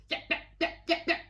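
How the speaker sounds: high, breathy yaps; yapping small dog. Acted. Harry McClary the little dog yaps, high. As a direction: yap like a tiny dog. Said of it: "said Harry McClary".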